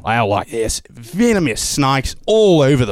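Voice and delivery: slow, broad Australian drawl; drawn-out Aussie warning; Australian accent. Shown as a demonstration. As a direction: stretch it out like an Australian. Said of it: "You wanna go to Australia".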